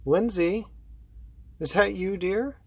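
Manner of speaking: high sing-song call up the stairs; auntie's high calling voice; aunt calling from upstairs. Acted. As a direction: call down sweetly to the girl. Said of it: "She's approaching a spiral staircase".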